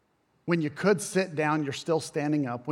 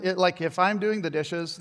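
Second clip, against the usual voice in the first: usual speaking voice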